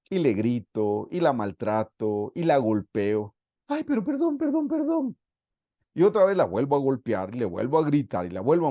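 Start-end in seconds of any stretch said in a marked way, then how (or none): 3.7-5.2 high, whining mock plea for forgiveness; whiny begging husband. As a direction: mimic a sniveling husband begging.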